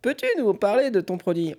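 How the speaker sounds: high cartoon duck voice. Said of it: "une voix de canard".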